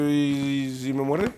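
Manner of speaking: slow, timid worried question; mock fearful Moses. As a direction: hang back like a scared man. Moses fears the snake.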